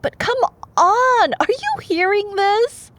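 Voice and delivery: high, loud disbelief; incredulous outburst. Real, not acted. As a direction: demand the listener share your shock. Outraged at the story.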